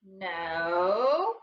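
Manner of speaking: ordinary talk, nothing marked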